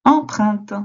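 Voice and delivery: called out loud; answer reveal. Shown as a demonstration. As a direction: announce the answer clearly. (réponse annoncée fort)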